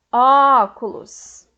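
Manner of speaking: "óculos" with Ó very loud and stretched; stressed tonic syllable. Shown as a demonstration. Teacher demonstrating proparoxítonas, "óculos" stressed loud on Ó. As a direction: hold and punch the Ó of "óculos". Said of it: "todas as sílabas tônicas possuem acento".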